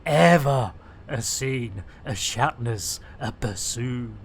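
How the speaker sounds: a hint of a low innuendo voice; low comic voice. Low put-on voice, innuendo joke.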